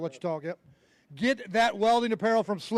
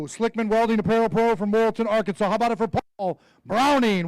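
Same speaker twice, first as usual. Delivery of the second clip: announcer intro; 5 dB louder than usual. Acted. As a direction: stretch the name out for the crowd. Introduces angler at weigh-in.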